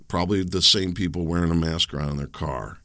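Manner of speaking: ordinary talk, nothing marked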